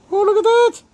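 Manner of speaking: high-pitched sudden delighted gasp of surprise; suddenly spot something amazing, high and loud; surprised exclaim. Real, not acted. Spots a hummingbird outside.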